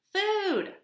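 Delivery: very high, loud squeaky begging; tiny baby bird crying for food; hungry gosling peep. Acted. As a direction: squeak for food like a starving chick.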